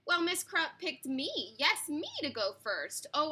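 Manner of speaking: ordinary delivery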